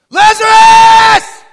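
huge high drawn-out shout; loud call. Acted. Jesus calling Lazarus from the tomb door, a huge shout. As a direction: call him out of the tomb with everything. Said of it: "And in a loud voice".

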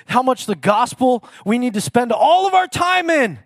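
high, loud pulpit preaching; preaching at full force. Acted. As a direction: drive the point home to the congregation.

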